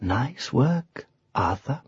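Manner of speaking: softly, confidentially. A narrator's reading. Witch praises lion once knight is out of earshot, softly. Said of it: "she said softly".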